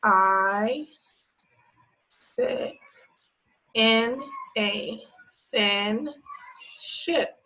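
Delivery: each word spoken alone with pauses; slow word-by-word reading. Shown as a demonstration. Teacher reading to kids, word by word. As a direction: read it one word at a time for the class. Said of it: "highlight the words as I read".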